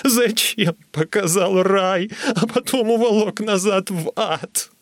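high, drawn-out wail; anguished, tearful lament; sobbing. A narrator's reading. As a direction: wail it through tears. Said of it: "рыдал я".

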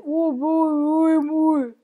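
high strangled "ui, mui" from a rusted mouth; a stiff rusty-jawed man straining to speak; creaky stove-maker. Retold or imitated. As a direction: squeeze out "U-, ui, ui, mui" as if the jaw barely moves. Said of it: "non riuscì a dire altro che".

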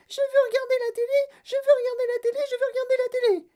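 tiny, extremely high squeal; shrieky little mouse-sized voice; yelping tantrum. Acted. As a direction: squeak it like a furious miniature boy. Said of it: "piqua une colère terrible".

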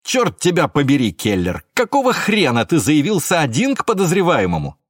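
high, heated dressing-down; angry scolding. A narrator's reading. Boss scolds officer for going alone to suspect, angry scolding. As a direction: tear into a subordinate, pitch jumping up. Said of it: "вздумала дать ему нагоняй".

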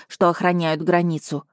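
usual speaking voice

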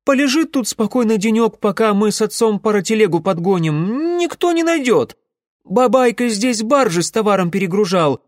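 high-pitched, insistent chatter; eager assurance. A narrator's reading. Alyoshka assures the being nobody knows the cave, eagerly confident. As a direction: talk him round, voice pitched well up. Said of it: "уверенно повторил он".

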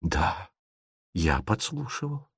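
very soft, halting confession; hushed admission. A narrator's reading. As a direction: admit it under your voice. He admits eavesdropping.

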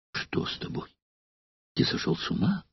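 breathless frightened whisper; gasping it out in fear. A narrator's reading. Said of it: "испуганно произнесла Валерия". (испуганным шёпотом)

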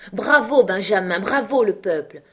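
loud, high, delighted bravo; can't hold his voice in any longer, let it burst out; an old host roaring his approval at the table; bursting cheer. A narrator's reading. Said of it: "ne pouvant plus contenir sa voix".

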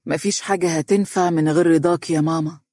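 plain reading voice

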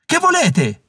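high-pitched challenge, acted yell; narrated shout, pitch thrown high; bracing shout. A narrator's reading. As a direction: cry out to give yourself courage. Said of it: "gridò per farsi forza".

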